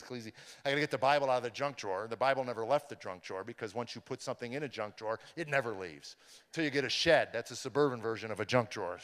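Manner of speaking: ordinary delivery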